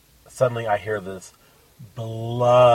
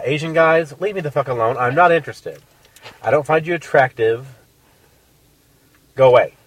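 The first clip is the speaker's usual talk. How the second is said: louder, higher fed-up telling off; dismissive brush-off; 5 semitones higher, 8 dB louder than usual. Acted. Addressing men who approach her, fed up and loud. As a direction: shoo them away firmly.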